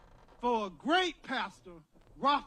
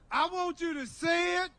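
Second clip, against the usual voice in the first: usual speaking voice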